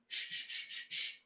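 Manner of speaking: breathy quiet shushes; whispered shushing. Shown as a demonstration. Teacher hushing the class with whispered shushes. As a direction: hush them all down.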